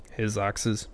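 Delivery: normal talking voice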